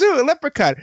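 playful mock wonder; delighted surprise. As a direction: coo at a tiny magical creature. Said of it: "Erica looks down and goes".

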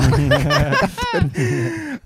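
high burst of laughter; loud laugh. Real, not acted. Laughing at the umbrella panic, a high burst of laughter.

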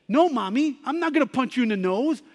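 put-on a child who won't hit his mom; mild mock childish protest; protesting little boy. Boy refuses his mother.

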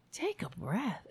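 soft mocking echo; soft repeat. Shown as a demonstration. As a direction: mimic it gently to herself.

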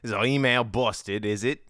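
mock Aussie questioning; Australian accent. Host reacting to listener's message, Australian accent. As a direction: query it in put-on Australian.

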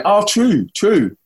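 high, falling Kiwi twang; New Zealand twang. Retold or imitated. Londoner back from touring New Zealand, Kiwi twang. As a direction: put on a picked-up Kiwi accent. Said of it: "I pick up a twang".

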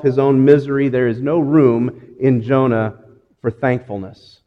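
ordinary talk, nothing marked

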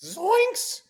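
high, cartoonish squeaky exclamation; Shaggy voice. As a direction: yelp it like a spooked cartoon stoner. Shaggy catchphrase while rating Shaggy.

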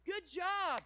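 put-on high, loud little-girl cheer; toddler cheering. Daughter cheering daddy through the bathroom door, high and loud. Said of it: "She goes".

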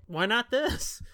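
ordinary talk, nothing marked

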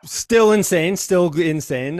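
amazed. Real, not acted. Host hearing the final trail totals, excited. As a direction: gush with real amazement.

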